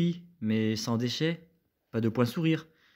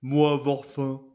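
ogre voice; 4 dB louder than usual. Acted. Ogre speaking broken French.

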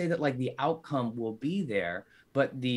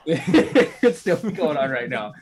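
laughing; 4 semitones higher, 10 dB louder than usual. Real, not acted. Repeats friend's joke, laughing.

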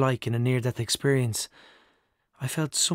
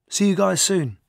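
loud, higher-pitched breezy farewell; called-out goodbye; 7 semitones higher, 7 dB louder than usual. A narrator's reading. Actor heads back to his agent, calling goodbye. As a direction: call it out to departing friends.

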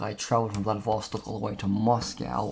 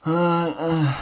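a long, real yawn pulling the voice up; tired yawn after a work day; 8 semitones higher, 3 dB louder than usual. Real, not acted. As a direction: yawn openly mid-thought. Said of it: "excuse me for yawning".

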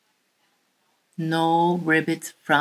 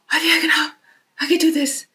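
excited confidence; 9 semitones higher, much faster than usual. Acted. Frog gaining confidence.

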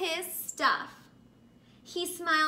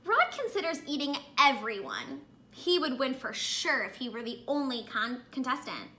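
ordinary delivery

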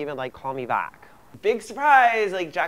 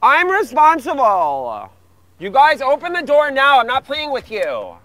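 shouting; 7 semitones higher, 6 dB louder than usual. Acted. Yelling at brothers through door.

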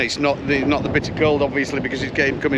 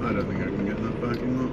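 muttered to self; 8 semitones lower, 9 dB quieter, faster than usual. Real, not acted. Muttering while driving.

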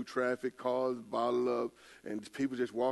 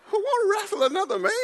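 incredulous shout; 9 dB louder than usual. Retold or imitated. Retold shock at stranger.

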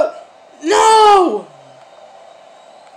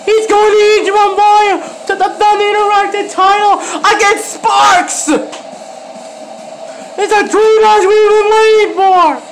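excited shout; 3 semitones higher than usual. Real, not acted. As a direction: announce the win like a ringside caller. Title win celebration commentary.

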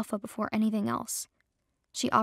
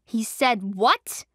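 slow, louder furious demand; roar; 5 dB louder, much slower than usual. Acted. Juniper roars on hearing what Reese said. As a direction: drag it out in disbelief and fury. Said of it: "her teeth bared as she roared".

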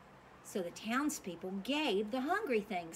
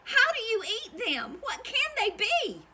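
much higher, fast astonished squeal; excited puzzled cry; 13 semitones higher, 6 dB louder than usual. Acted. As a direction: shriek out in baffled wonder. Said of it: "cried the townspeople".

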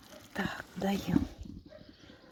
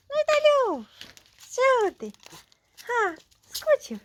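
pet talk; 10 semitones higher than usual. Real, not acted. Greeting her cat Vitaliush in a high cooing voice.

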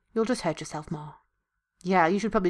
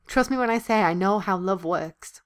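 usual speaking voice

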